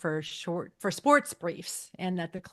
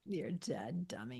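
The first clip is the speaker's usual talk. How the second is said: low, much softer threat; quiet menace; 5 semitones lower, 12 dB quieter, slower than usual. Retold or imitated. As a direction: murmur it like a deadly private warning. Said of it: "Yeah, that was good".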